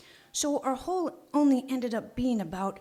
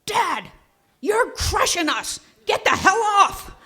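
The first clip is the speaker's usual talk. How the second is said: high, loud, slow yell from below; hollering; 10 semitones higher, 11 dB louder, slower than usual. Retold or imitated. The boy under the plywood hollers up at his dad, loud yell. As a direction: yell up desperately at the man on top of you. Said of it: "Chris hollers up".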